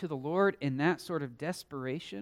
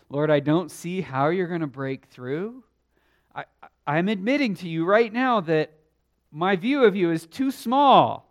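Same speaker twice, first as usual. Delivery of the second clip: praying voice; 2 semitones higher, 7 dB louder than usual. Acted. Preacher voices a desperate prayer.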